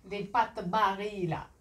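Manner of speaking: put-on playful Italian accent on the brand name. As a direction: ham up Barilla the Italian way. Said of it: "avec mon accent, euh, italien".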